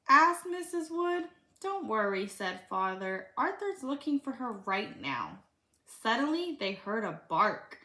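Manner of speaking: put-on reading a picture book aloud to a child; lively read-aloud for a little one; storybook reading.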